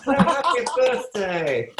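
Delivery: high, loud, stretched-out congratulations; celebratory cheer. Real, not acted. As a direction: cheer it slowly for the whole class. Leads class in birthday wishes.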